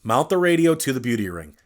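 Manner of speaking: normal talking voice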